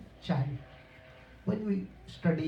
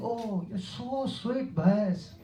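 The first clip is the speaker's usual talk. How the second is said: syrupy camel flattery; 4 semitones higher, much slower than usual. Retold or imitated. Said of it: "But camel saying".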